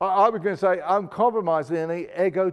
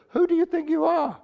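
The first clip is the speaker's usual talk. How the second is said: much higher, incredulous challenge; indignant challenge; 11 semitones higher than usual. Acted. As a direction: throw the question out as an outraged crowd.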